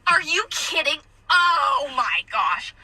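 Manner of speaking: slow, incredulous mock questioning; appalled customer impression. Customer to server about learning from TikTok, appalled. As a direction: imitate scandalized disbelief, then drop away. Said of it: "these expressions are her expressions".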